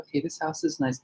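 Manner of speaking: quiet inner musing; husband calming himself while touring the yard; soft. Retold or imitated. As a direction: say it softly to yourself. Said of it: "I'm like".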